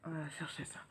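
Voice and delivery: hushed aside under the breath; whispering. Real, not acted. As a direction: whisper it to yourself. Aside while fetching something.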